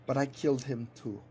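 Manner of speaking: put-on accented villain, measured and mournful; villain captain, regretful. Captain pleads with cowboy who reminds him of his brother, regretful villain. Game or story villain's speech.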